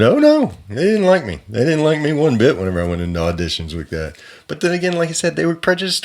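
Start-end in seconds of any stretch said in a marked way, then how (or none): 0.0-0.5 sarcastic oh no. Real, not acted.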